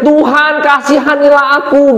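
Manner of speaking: high-pitched cry for mercy; imitated loud plea. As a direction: act out begging God at a high pitch.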